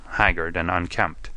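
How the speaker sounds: ordinary delivery, nothing marked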